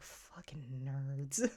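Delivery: slow, breathless mock whisper of disdain; an unvoiced, dragged-out jeer. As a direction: hiss it out slowly like a scoffing aside. Characters mocking the D and D naming.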